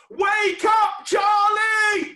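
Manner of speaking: very loud, high holler; demonstrated wake-up shout. Shown as a demonstration. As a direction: show the kids how loud to yell. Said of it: "you just need to shout".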